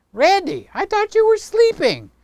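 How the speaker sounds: delighted surprise; surprised greeting. Real, not acted. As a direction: pop up in surprise at a friend.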